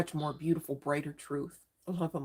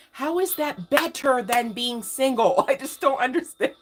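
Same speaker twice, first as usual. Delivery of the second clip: higher, louder, slowed incredulous question into a laugh; laughing disbelief; 7 semitones higher, 6 dB louder than usual. Real, not acted.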